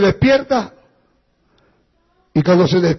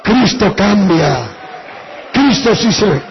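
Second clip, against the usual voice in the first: pastor's fervent altar-call preaching; preaching at full force; 4 dB louder than usual. Real, not acted. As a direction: proclaim the name with fervor. Preacher rises to climax.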